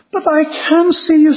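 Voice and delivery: high-pitched insistence, stressing "can"; insistent protest. A narrator's reading. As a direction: protest eagerly in a high storybook voice. Lucy insists she can see.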